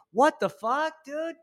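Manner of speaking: squeaky what-the-fuck; shocked squeak. Acted. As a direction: blurt it in alarm. Stoner shocked by Jesus.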